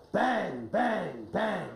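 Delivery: slow, spaced-out knocking words; wolf banging. Shown as a demonstration. Coach showing how the wolf knocks on the door, banging. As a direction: say each knock as its own drawn-out beat. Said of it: "the wolf could go".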